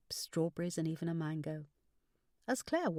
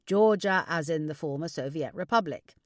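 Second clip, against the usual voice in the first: louder fatherly clarification; correcting father; 7 dB louder than usual. Acted. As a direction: correct her as a knowing father.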